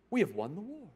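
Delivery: put-on English accent. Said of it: "That's his, that's his English accent".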